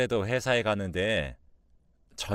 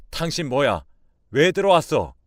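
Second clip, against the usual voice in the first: louder, higher angry demand; angry confrontation; 4 semitones higher, 8 dB louder than usual. A narrator's reading. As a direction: challenge an intruder angrily. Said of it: "화를 내면서". (화를 내며 따지는 말투)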